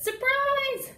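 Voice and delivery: high, loud Surprise; spring it on him, high; surprise shout. Acted. Frogolina springing her surprise on Froggy, high and loud. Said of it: "and said".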